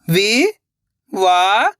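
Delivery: very slow, stretched syllables, rising at the end; sounding out syllables. Shown as a demonstration. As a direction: sound it out slowly, letter by letter. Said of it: "एक एक अक्षर का उच्चारण करके".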